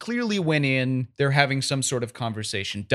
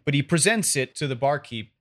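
usual speaking voice

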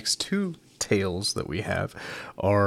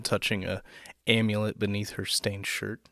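ordinary delivery

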